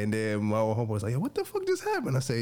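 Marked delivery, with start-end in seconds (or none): 1.1-2.2 very high, squeaky disbelief; stunned friend. Retold or imitated. Homeboy asking him about the scene, squeaky astonishment. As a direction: imitate a baffled buddy in a squeal.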